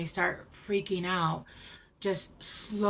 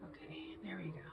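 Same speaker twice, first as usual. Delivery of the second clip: faint whisper; 3 semitones lower, 17 dB quieter, faster than usual. Real, not acted. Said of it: "That's really bad".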